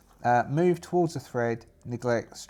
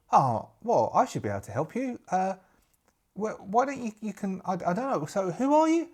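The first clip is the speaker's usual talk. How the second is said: put-on higher, hesitant young woman's voice; young kind-hearted witch; 7 semitones higher than usual. Green witch offering help to a lost pirate, hesitant high voice. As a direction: offer help, a little unsure.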